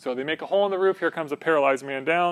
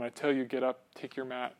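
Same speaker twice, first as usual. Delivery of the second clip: gentle quiet command; 4 semitones lower, 10 dB quieter than usual. Acted. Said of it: "He says to the paralyzed man".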